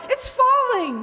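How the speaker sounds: alarmed cry. Acted. Bookcase falling.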